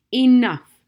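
loud, high, final; sharp loud enough. Acted. Gloria stopping her husband's questioning, sharply loud. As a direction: shut the talk down firmly.

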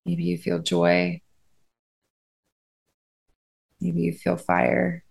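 unhurried, steady phrases, spaced out to let them breathe; guided meditation. Acted.

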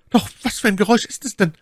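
quick, high-pitched lady's question; Milady's voice. A narrator's reading. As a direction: press for an answer, fast and high. Milady worries about the noise. (schnelle, hohe Frauenstimme)

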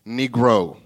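low, loud, commanding single word; the Lord. Acted. The Lord rebuking the preacher, deep and commanding. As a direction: speak down firmly in a deep voice. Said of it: "That's how he speaks to me".

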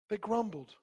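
soft, subdued restatement; dropped-volume pulpit aside; hushed repetition. Real, not acted. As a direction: repeat it quietly after a long pause. Repeats the answer after long pause.

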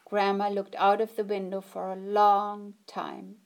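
ordinary delivery, nothing marked

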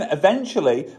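normal talking voice

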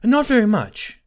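high, loud anxious maiden. A narrator's reading. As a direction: jump up high with nervous worry. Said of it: "she said anxiously".

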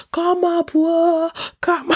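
very high calling out; mock shouting mother. Mother shouting for the husband to come up. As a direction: call him up the stairs sharply. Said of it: "she started shouting his name".

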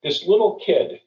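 ordinary talk, nothing marked

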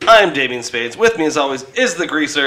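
loud upbeat host welcome; podcast host voice. Acted. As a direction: pump up the crowd. Hosting welcome, loud and lively.

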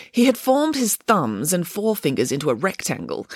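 ordinary delivery, nothing marked

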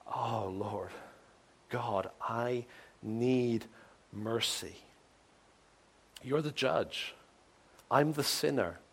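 soft, very slow plea for mercy; a hushed cry of desperation; desperate cry. Retold or imitated. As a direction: beg quietly, drawn out. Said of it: "It's just a cry of".

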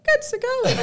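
high, cheesy chat-up voice; laughing high imitation of a flirt; Nick impression. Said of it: "you're doing a Nick now".

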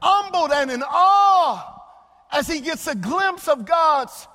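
slow, loud pulpit build; swelling sermon proclamation; preaching. Acted. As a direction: preach it out with full force. Preacher building to full force.

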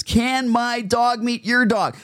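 high, drawn-out mock request; put-on high voice of an intrusive stranger; eager dog owner. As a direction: imitate a pushy owner asking sweetly. Mocking owners who approach dogs.

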